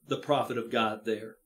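usual speaking voice

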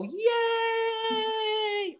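high, loud cheer with arms up; joyful cheer; cheering yay. Shown as a demonstration. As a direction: shout yay like a celebration. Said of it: "throw up our arms and go".

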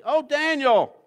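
high, loud, desperate calling out; anxious cry. A narrator's reading. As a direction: cry out to Daniel in fear for his life. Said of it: "he cried out anxiously".

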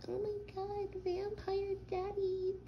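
teasing his girlfriend with a high, slow mock plea. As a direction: mimic her begging in a squeaky voice. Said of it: "I walked in on you saying it".

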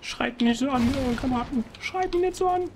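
high put-on whine; mock whiny plea. Streamer mock-begging his in-game teammates, high whiny plea. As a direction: beg them like a hurt child.

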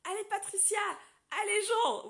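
high, loud cheering from the sidelines; cheering names. Retold or imitated. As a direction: call out like a race supporter. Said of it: "je les appelais par leur prénom en disant".

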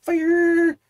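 high hyped shout; excited shout. Real, not acted. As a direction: call it out loud and pumped. Hyped reaction to the band.

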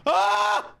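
sudden high-pitched scream; startled scream. Real, not acted. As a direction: shriek at a jump scare. Startled by jump scare in game.

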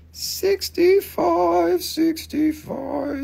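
very high, drawn-out croon; playful price call. Pretend. Seller repeats the opal's price, high and drawn out. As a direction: stretch each word slowly in a high voice.

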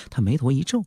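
ordinary delivery

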